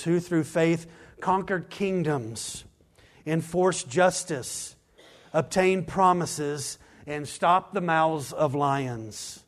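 stately proclaiming read; slow scripture reading. Acted. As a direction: lay each phrase down slowly. Reads scripture with force.

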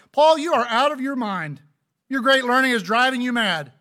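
high, loud accusing outburst. Acted. Festus interrupts Paul's defense before the king, loud outburst. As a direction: raise your voice, loud and high, to accuse him. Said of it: "Festus said in a loud voice".

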